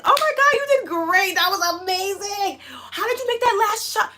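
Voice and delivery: high, loud excited squeal of praise; gushing congratulations. Retold or imitated. Child gushing to the winner who beat them, thrilled. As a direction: cheer like a thrilled, amazed fan.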